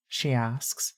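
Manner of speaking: usual speaking voice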